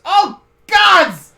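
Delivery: loud, high shout of dismay; panicked pawn yell. Acted. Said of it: "The pawn suddenly looks up and goes".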